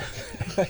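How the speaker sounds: a short real laugh at his own joke. Real, not acted. Pastor laughing at his own boast about size. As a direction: let a quick laugh slip out.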